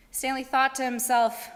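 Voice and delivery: plain reading voice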